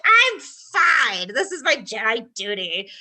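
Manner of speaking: imitating a stubborn dutiful knight; Stellan brushing off. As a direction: insist you're fine, dutiful. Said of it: "And he was like".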